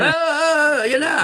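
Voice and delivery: high-pitched mock clamour; hammed-up, high wailing cry; mock hothead. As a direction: mimic a reckless agitator raising the alarm. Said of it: "hindi yung ganyan".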